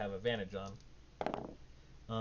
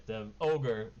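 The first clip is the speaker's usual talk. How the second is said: usual speaking voice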